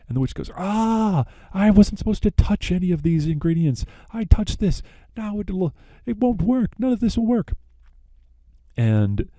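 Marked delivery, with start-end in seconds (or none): 0.6-7.5 much higher, hammed-up shrieking dismay; witch's dismay. The witch realizes she touched the ingredients, hammed-up despair. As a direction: wail it like a witch whose spell is ruined. Witch discovers the ruined spell.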